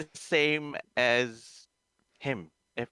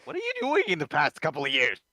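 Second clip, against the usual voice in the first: exasperated rant; 3 semitones higher, 4 dB louder than usual. Real, not acted. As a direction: demand an answer in disbelief. Ranting at fans waiting for years.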